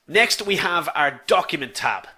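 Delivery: usual speaking voice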